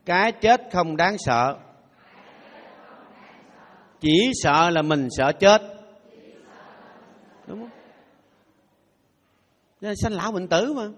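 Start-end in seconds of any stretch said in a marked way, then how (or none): 0.1-5.6 slow recitation. Shown as a demonstration. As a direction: say each word slowly, weighty. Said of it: "Đọc theo Thầy". (đọc chậm rãi từng chữ)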